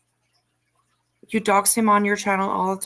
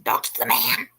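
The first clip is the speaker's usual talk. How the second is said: whispering; 8 semitones lower than usual. Pretend. Whispered final chant.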